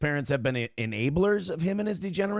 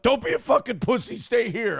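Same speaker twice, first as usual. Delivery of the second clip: angry taunt; 8 semitones higher than usual. Retold or imitated. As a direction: needle him into staying. Nick insisting he stay.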